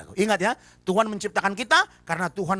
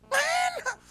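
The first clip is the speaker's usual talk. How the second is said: shouted complaint; slower than usual. Shown as a demonstration.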